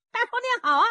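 high, loud hello; eager greeting. Acted. Zhao Bi greets Uncle Qian, high and eager.

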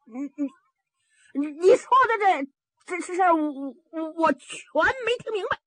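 high, halting stammer; stuttering. A narrator's reading. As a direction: get stuck on the first words, then push the sentence out. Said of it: "口吃， 就是结巴磕子".

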